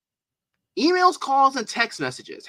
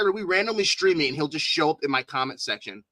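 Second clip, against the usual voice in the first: ordinary talk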